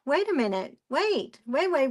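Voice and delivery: mock the hens' outrage, stretching each wait; indignant hens. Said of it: "They were like".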